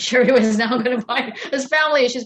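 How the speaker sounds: amused, laughter breaking into the words; laughing. Real, not acted. Guest joking about a client's family issues, laughing.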